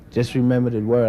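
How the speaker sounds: normal talking voice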